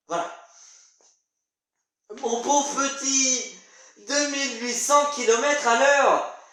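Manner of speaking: theatrical lecture, swelling at each marvel; excitable explainer. Acted. Explaining modern marvels to thawed man, excitable.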